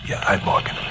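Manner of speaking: voiceless and soft; too spent to argue; weak whisper. Acted. Castaway giving in to the fisherman, weak whisper. Exhausted castaway admits identity.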